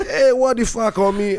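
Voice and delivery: high, loud outraged shout; indignant yell. Retold or imitated. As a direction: yell it back, offended. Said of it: "I say".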